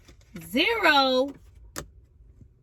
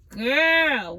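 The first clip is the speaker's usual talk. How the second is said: normal talking voice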